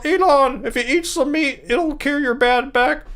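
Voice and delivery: high-pitched Kermit-like professor impression; strained muppety voice, drawing out the end; Jordan Peterson. Streamer testing an impression on live viewers, Jordan Peterson impression. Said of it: "my impression of Jordan Peterson".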